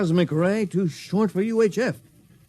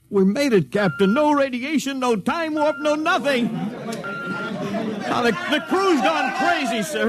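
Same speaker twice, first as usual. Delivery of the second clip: higher, bursting with relief; excited relief; 9 semitones higher, slower than usual. Acted. Crewman telling the captain they survived, excited. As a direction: blurt it out, thrilled to be alive.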